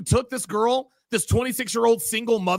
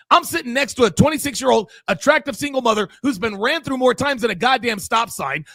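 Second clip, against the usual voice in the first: ordinary talk, nothing marked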